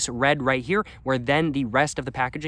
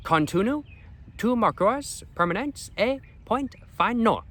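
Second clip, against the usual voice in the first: high, slow, halting French accent; 9 semitones higher, slower than usual. Shown as a demonstration. Vlogger reading package contents aloud, attempted French accent. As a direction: sound out the French slowly, pitched up. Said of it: "under that in French".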